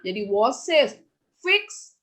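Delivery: normal talking voice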